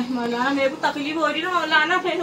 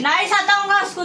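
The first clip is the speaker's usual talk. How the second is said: raised, higher-pitched forced promise; real raised-voice reply; louder on demand; 5 semitones higher than usual. Real, not acted. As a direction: call it out higher because you were ordered to. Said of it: "जोर से।".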